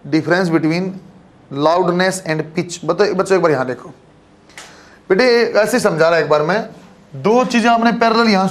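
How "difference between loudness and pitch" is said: very slow, word by word for note-taking; teacher dictates a topic title to students, slowly spelling it out; slow dictation. Shown as a demonstration. Dictating a heading to the class, very slow and deliberate. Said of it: "छोटा topic से बना लो".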